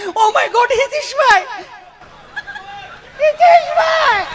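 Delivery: hammed-up scared lady; panicked squeal. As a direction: shriek for help in mock panic. Frightened lady runs to him.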